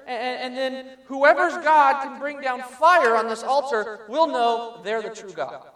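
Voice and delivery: Elijah proclaiming. Acted.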